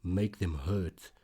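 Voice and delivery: usual speaking voice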